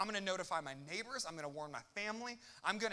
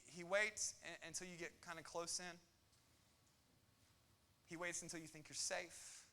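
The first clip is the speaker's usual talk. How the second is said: ordinary talk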